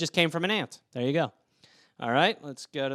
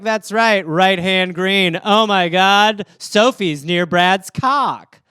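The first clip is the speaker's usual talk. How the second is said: loud, slow game-caller excitement; Twister caller; 6 dB louder, much slower than usual. Retold or imitated. Friend calling naked Twister moves, loud and slow. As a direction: call out the moves like an overexcited host. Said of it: "You don't need to be like".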